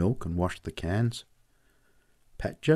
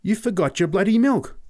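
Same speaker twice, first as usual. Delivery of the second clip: much higher, louder exclamation; astonished; 11 semitones higher, 5 dB louder than usual. Acted. Boy telling Pat he forgot the milk, astonished. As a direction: blurt it out amazed. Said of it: "I said in astonishment".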